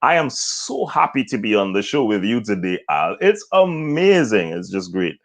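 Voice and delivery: joy put into the voice, key words stretched; happy, expressive. Shown as a demonstration. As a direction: gush with delight to be on the show. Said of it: "You have to have expression".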